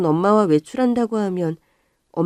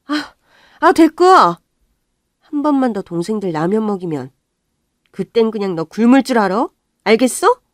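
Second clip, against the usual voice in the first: scolding mother; 3 semitones higher, 6 dB louder than usual. Acted. Mother cuts daughter off.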